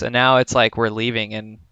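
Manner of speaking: usual speaking voice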